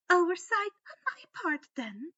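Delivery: sweet menace from a high perch; Umbridge voice. Acted.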